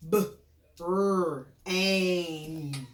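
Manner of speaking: brain split into B, brr, aim with pauses; sounding out phonemes. Shown as a demonstration.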